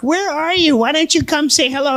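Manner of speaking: high-pitched imitated lady; put-on old lady's voice. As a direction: ask it high, coaxing the secret admirer. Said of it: "And she's like".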